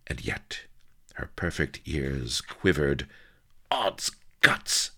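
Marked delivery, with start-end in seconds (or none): 3.7-4.9 sharp whispered hiss. A narrator's reading. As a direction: hiss it under the breath. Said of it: "she hissed".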